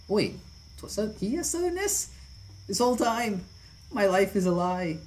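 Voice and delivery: very high, loud disbelief; hammed-up betrayal; playful shocked wail; mock shock. Real, not acted. Actor shocked by accent reveal.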